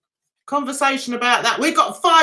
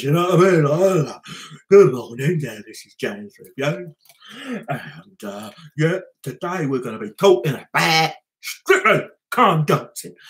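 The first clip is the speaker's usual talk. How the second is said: much lower, groggy radio host; deep morning voice; 8 semitones lower than usual. Shown as a demonstration. Radio host before coffee, low deep morning voice. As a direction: croak it out like you just woke up. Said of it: "my deep morning voice".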